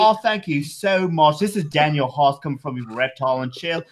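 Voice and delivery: hammed-up mock British accent. Host thanking listeners in the outro, mock British accent. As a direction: put on a fake British accent for a sign-off. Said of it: "In British accent".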